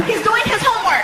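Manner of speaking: high woman's voice, amused; girlfriend voice. Retold or imitated. Girlfriend reporting on the son, high woman's voice.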